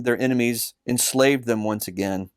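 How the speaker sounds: plain reading voice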